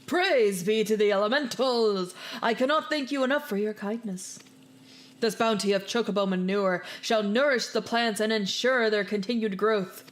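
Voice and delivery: grateful gardener. Acted. Gardener NPC thanking the adventurer, reverent and loud. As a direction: proclaim it like a pious gardener.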